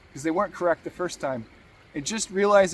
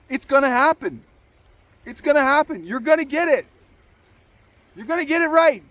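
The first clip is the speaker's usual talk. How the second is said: higher and louder, building to the last line; rising encouragement; 8 semitones higher, 4 dB louder, faster than usual. Acted. Vlogger promising viewers success, rising excited push. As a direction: pump them up, climbing.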